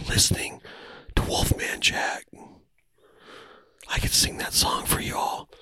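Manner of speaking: soft unvoiced whisper, drifting. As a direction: whisper them to sleep. Whispered sleep talk.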